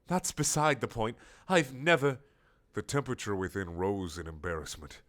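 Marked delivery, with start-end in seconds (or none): 0.1-2.2 higher, prim flustered protest; embarrassed prim castle voice. Acted. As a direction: splutter it up high.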